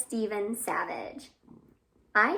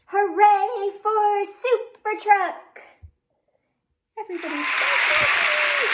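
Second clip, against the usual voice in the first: much higher, louder, slow joyful cheer; 9 semitones higher, 9 dB louder than usual. A narrator's reading. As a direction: cheer for the hero with the kids. Cheering for the hero truck.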